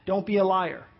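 normal talking voice

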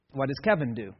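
ordinary talk, nothing marked

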